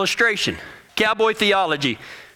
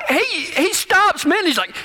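normal talking voice

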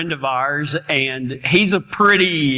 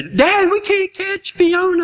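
put-on kids whining; 13 semitones higher, slower than usual. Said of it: "and they".

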